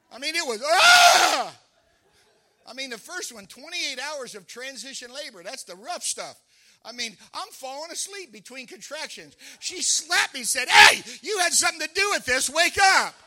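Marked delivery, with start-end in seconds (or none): 0.6-1.6 sharp, high mock scream of pain; imitating his wife's cry during labor. 10.7-13.1 loud, high scolding yell; wife shouting at her dozing husband in labor; angry shout. Retold or imitated. Wife slaps sleepy husband.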